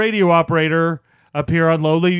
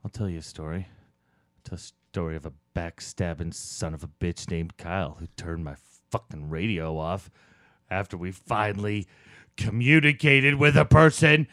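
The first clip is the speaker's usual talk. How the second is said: bitter, building rage; 9 semitones lower, 3 dB louder than usual. Acted. Bitter tale builds into outburst.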